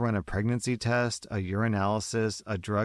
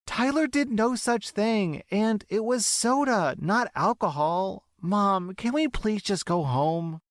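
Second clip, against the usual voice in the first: much higher, near tears plea; pleading teen girl; 12 semitones higher than usual. Acted. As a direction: beg mom to go home. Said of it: "pleading ... nearly in tears".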